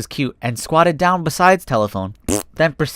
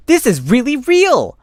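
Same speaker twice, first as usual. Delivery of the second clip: much higher, slow and awed; thrilled; 10 semitones higher, 4 dB louder, slower than usual. Acted. Fox realizing his dream came true, thrilled and high. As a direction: let the wonder lift the voice.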